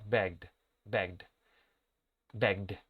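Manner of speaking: low, measured "Begged", each time one syllable, begd. Shown as a demonstration. Teacher drills the class, "Begged" with -ed as D. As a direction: repeat "Begged" with a voiced D ending and no second vowel. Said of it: "se va a pronunciar la E D como si fuera una D".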